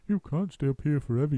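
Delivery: lightly voiced bird; a friendly bird's lilt; chirpy roly-poly bird. A narrator's reading. Roly-poly bird warning Muggle Wump gently.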